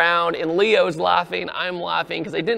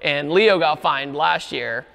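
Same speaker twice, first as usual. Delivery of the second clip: ordinary talk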